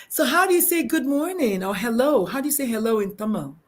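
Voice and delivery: normal talking voice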